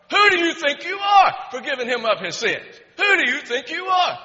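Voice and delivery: loud, high shouted indignation; berserk outrage. Retold or imitated. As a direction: shout it as the enraged elders challenging Jesus. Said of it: "They went berserk".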